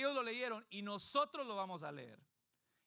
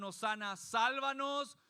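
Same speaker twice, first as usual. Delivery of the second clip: crowd's acclaim; 5 semitones higher, 6 dB louder, slower than usual. Retold or imitated.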